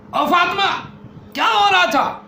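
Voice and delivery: loud high angry demand; angry call. Acted. Brother shouting at sister Fatima, angry. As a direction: shout her name and demand. Umar angrily calls his sister.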